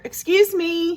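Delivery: high, sweetly polite call. A narrator's reading. Wolf at Missus Duck's door, sweetly. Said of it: "he said sweetly".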